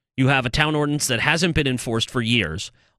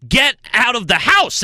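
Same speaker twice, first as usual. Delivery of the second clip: ordinary talk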